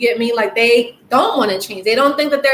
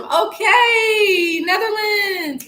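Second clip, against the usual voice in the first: high, drawn-out excited welcome; light up as you greet them, stretching Netherlands; 9 semitones higher, much slower than usual. Real, not acted. Greeting a viewer from the Netherlands in the chat, excited.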